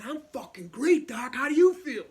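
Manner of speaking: very loud, high-pitched yell; out-of-it shouting. Retold or imitated. Out-of-it patient to his doctor, wildly over-loud shouting. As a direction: throw the question back in a brash shout. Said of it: "really out of it".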